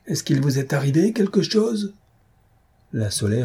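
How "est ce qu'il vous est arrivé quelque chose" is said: mild high-pitched call across the water; raised-pitch narrated holler. A narrator's reading. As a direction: call out through cupped hands, without full volume. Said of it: "Faisant porte-voix de ses mains, il cria".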